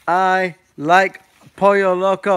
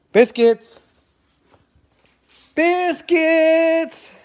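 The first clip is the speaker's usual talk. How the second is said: calling the dog; 12 semitones higher, much slower than usual. Real, not acted.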